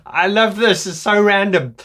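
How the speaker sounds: high, loud, tickled delight; giggly and delighted; real amusement. Real, not acted. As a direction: say it just coming out of a laughing fit. Cracked up by own impression.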